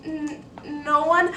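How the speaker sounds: put-on high, loud, slow stammering girl; frightened stammer. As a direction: blurt it out scared and caught.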